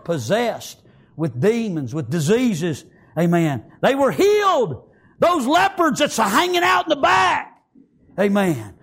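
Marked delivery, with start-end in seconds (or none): 3.8-7.6 high, sustained pulpit fervour; full-force preaching. Acted. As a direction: preach it in driving, high-pitched waves.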